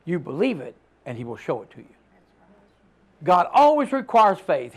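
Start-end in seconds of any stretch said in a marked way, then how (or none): 0.0-1.9 firm quiet answer. Retold or imitated. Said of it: "I said".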